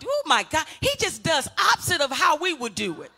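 rousing pulpit delivery; preaching at full force. Acted. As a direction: preach it out to the whole church. Pastor preaching to congregation.

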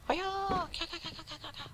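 high hammed-up karate chant ka ka; mock battle cry. Stabbing ghosts with his table knife, hammed-up high battle cry. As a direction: play a cartoon fighter striking. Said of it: "signature move".

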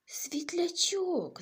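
high, slow, hushed wonder; amazed whisper. Acted. Bunny discovering the firefly, hushed and amazed. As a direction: breathe the word in amazement.

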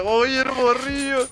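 high and drawn out, teasing his younger self; mocking high voice. Teasing himself in a high drawn-out mock voice. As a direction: stretch it out slowly like a teasing onlooker.

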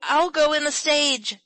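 put-on high, loud boyish yell; shrieked demand, pitched right up; screamed. A narrator's reading. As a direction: yell it out, desperate to go. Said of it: "screamed Joel".

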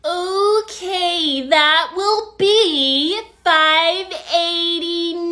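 very slow, loud, singsong drawl; mocking over-enunciation. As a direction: stretch every word to mimic the customer. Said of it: "Why are you talking like that?".

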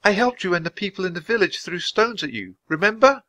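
put-on high-pitched little girl voice; high, earnest girl's appeal; pleading girl. A narrator's reading. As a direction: remind the bird earnestly. Annie pleads with the bird.

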